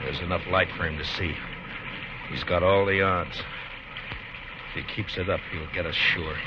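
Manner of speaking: low, soft, tense planning under fire; hushed. Acted. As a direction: keep your voice down, he's listening. Whispered planning pinned down.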